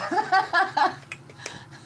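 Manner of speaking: high burst of laughter; real, high-pitched amused laugh. Real, not acted. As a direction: laugh out loud in surprise. Amused at remark on her accent.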